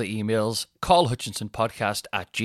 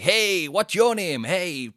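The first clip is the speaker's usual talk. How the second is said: high, slow stage-host greeting; comedy mode compere; 7 semitones higher, 3 dB louder, much slower than usual. Retold or imitated. Comedian greeting the audience, high and drawn out. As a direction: greet the crowd like a cheesy compere. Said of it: "I'm in comedy mode".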